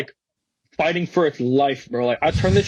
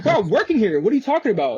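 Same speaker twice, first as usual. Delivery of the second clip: raised-pitch retold indignation; indignant protest; 5 semitones higher, faster than usual. New valet answers suspicious staff, indignant protest. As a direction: protest like you can't believe they're asking. Indignant reply when challenged at work.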